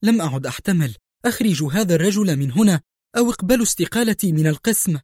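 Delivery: a nurse at the end of her rope; frantic outburst. A narrator's reading. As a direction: nurse pleading with the doctor, frantic and rising. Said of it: "هتفت الممرضة في انهيار".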